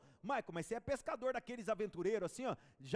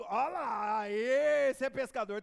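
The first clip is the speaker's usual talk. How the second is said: slow shout, a lot louder; booming slow announcement; loud proclaiming; 4 semitones higher, 9 dB louder, much slower than usual. Real, not acted. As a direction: cry it out to the whole church, slowly. Shouting praise about real fisherman.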